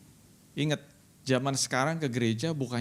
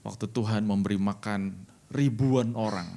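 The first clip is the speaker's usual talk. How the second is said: ordinary delivery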